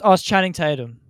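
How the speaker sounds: usual speaking voice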